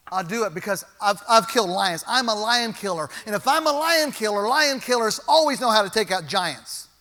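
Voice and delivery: loud, driving sermon delivery; full-force preaching. Acted. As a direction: preach it hard and build the fire.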